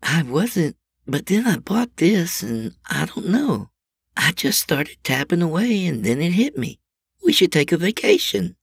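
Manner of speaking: like the greatest epiphany ever; revelation. A narrator's reading. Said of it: "sound of revelation in her voice".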